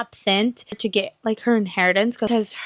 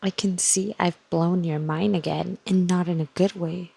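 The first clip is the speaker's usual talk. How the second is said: hushed reactions. Real, not acted. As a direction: keep it hushed so nobody wakes. Late-night hushed reading reactions.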